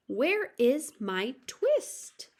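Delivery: slow plaintive call. Acted. Old tailor asking his cat for the twist, slow and pleading. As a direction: plead each word slowly.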